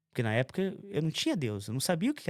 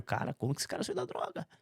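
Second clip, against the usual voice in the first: a whispered aside of disbelief; gossiping whisper; 7 dB quieter than usual. Retold or imitated. Acquaintances wondering how he quit drugs, whispered gossip. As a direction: whisper it behind his back.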